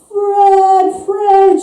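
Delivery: high, eerie, drawn-out calling of Fred; a dead wife's voice luring him from beyond; ghostly wife calling. A narrator's reading. The haunting wife calls her husband's name, high and eerie. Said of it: "she calls".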